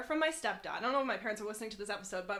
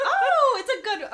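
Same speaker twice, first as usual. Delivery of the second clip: surprised; 12 semitones higher, 12 dB louder, slower than usual. Real, not acted. Reacting to reading question.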